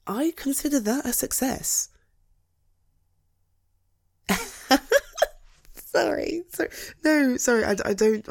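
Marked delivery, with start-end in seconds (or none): 4.3-5.3 high burst of laughter, caught off guard; sudden laugh. Acted. Husband bursting out laughing at his wife's bad day, sudden laugh. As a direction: laugh despite yourself, then catch it. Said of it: "I don't mean to laugh".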